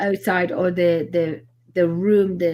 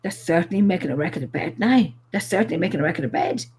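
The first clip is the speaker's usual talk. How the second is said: imitated northern accent, pitched a little lower; 4 semitones lower, faster than usual. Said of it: "and in northern accent".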